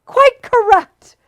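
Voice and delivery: high, slow, loud shriek of triumph; triumphant shriek. A narrator's reading. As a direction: crow your victory. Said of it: "he shrieked triumphantly".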